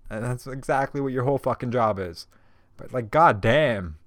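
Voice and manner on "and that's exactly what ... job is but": usual speaking voice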